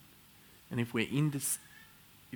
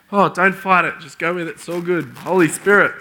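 overcome exclamation; 5 semitones higher, 10 dB louder than usual. Real, not acted. Exclaiming while overcome by emotion.

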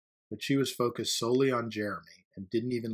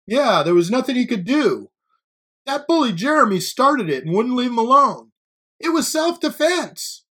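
high, loud shouts from a crowd; piping in; 13 semitones higher, 12 dB louder than usual. A narrator's reading. Students in the crowd defending Jake, piping in loudly. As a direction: pipe up loudly in someone's defense. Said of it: "there were other voices piping in".

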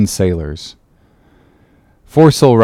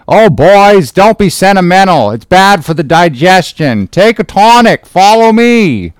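high, loud rowdy sailor voice; rally your mates jovially; hearty sailor; 9 semitones higher, 5 dB louder than usual. Acted. First Nantucket sailor voiced.

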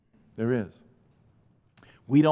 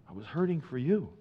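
soft echo; 7 dB quieter than usual. Acted.

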